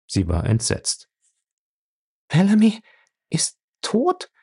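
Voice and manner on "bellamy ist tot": high, rising gasp of disbelief; can't believe what she's hearing; disbelieving. Acted. Fay hearing from Julius that Bellamy is dead, in disbelief. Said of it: "sagte sie ungläubig".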